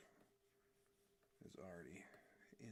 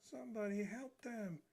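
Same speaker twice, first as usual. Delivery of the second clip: higher, calling out for help; cartoon cry for help; 13 semitones higher, 3 dB louder than usual. Streamer voicing the pointing guy, high call for help. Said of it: "He's, like, pointing".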